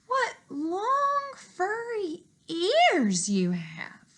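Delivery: put-on high, slow, wondering girl voice; a curious little girl peering close. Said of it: "coming closer".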